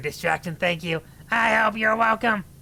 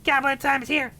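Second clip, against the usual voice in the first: goblin voice; 6 semitones higher than usual. Acted. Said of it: "For the voice, it's goblin time".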